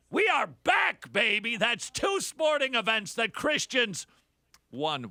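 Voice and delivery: high, loud victory cry; over-the-top jubilant proclamation; mock triumph. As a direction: whoop it up like the team just won.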